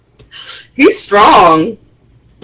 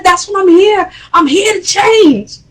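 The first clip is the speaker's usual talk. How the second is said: son yelling; 11 semitones higher than usual. Retold or imitated. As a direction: shout it at your father. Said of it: "He said".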